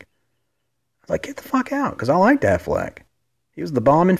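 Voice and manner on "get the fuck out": stunned disbelief. Retold or imitated. Teen him amazed at compliment.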